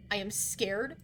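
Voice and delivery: ordinary talk, nothing marked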